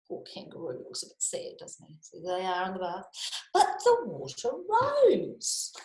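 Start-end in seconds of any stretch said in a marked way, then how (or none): 3.5-5.7 high and slow, drawn-out wonder; slow, high storyteller's reveal; dramatic reveal. A narrator's reading. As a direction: stretch it out with high suspense. Suspense line in story.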